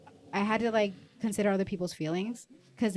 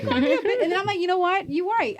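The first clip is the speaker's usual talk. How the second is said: high, loud indignant retort; high loud mock outrage; 11 semitones higher, 6 dB louder than usual. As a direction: play it up as a comeback. Retold exchange with brother-in-law.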